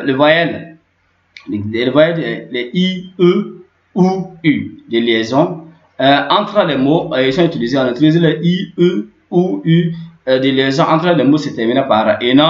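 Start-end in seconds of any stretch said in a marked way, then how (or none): 3.2-4.8 E, OU, U each held long and higher; drawn-out vowel demo. Shown as a demonstration. Teacher listing linking vowels for learners, drawn-out vowel demo. 8.8-9.9 E, OU, U each held long and higher; drawn-out vowel demo. Shown as a demonstration. Teacher listing linking vowels for learners, drawn-out vowel demo.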